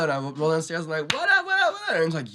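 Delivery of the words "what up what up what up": very high, loud hyped-up greeting. Retold or imitated. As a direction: bounce in calling out to everyone. Rolling downstairs greeting everyone.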